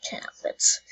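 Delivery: dismayed whisper. Real, not acted. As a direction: breathe it out in disbelief. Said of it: "repeated in a whisper".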